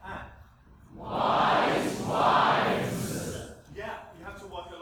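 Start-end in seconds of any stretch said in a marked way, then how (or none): 1.0-3.3 loud voiceless "wives" with a heavier V; forceful whispered "Wise wives" with buzzing V; more V. Shown as a demonstration. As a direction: lean hard on the V in "wives". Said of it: "Do more V".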